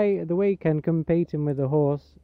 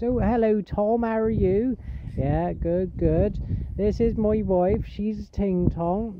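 put-on higher, slow Gloucestershire farmer accent; farmer's accent; 5 semitones higher, slower than usual. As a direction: play a welcoming West Country farmer host. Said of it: "traditional farmer's accent".

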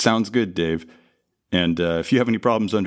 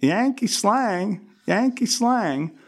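much higher, slow, cartoon American twang; over-the-top Yankee accent; 12 semitones higher, slower than usual. Shown as a demonstration. Actor imitating the director's over-the-top Yankee accent. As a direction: drawl it like a bad American caricature. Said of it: "I'll just imitate you".

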